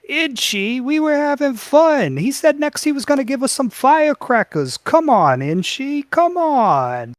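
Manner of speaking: put-on high, pleading children's voice; whining goblin kids. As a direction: beg like a bunch of kids. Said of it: "the goblin kids go".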